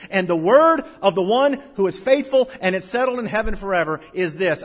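preaching at full force. Acted. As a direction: proclaim it to the whole hall.

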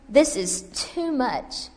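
very slow, drawn-out complaint; slow despair. Acted. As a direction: stretch each word in weariness. Repeated line, first take.

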